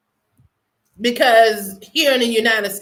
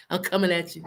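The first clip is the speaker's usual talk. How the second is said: low, soft mock threat; mock menace; 8 semitones lower, 10 dB quieter, much faster than usual. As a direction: tease them with a quiet mock threat. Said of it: "I'm just messing with you guys".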